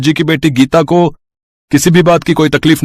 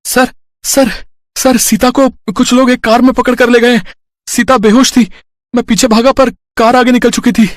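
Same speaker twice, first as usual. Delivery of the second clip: much higher, breathless report; urgent alarm; 6 semitones higher than usual. Acted. Boy running to the manager, urgent and much higher. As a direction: rush in with bad news.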